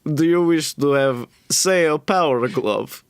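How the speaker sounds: put-on goblin tempter with a Dracula-like Eastern European accent. Goblin offering a traveler power, Eastern European accent. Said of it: "He's like".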